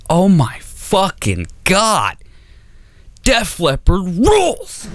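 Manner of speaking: high, slow stoner awe; stoned rapture. Retold or imitated. After first hits of a joint, slow high stoner awe. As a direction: marvel like you're blissfully high. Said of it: "just being like".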